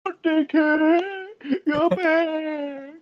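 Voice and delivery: put-on very high, drawn-out elderly wail; hammed-up old person. Teased friend playing old and dim-sighted, hammed-up elderly voice.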